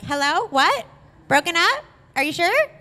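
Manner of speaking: high, rising questions; retold disbelief. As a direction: question it piece by piece, incredulous. Stunned reaction to her plans.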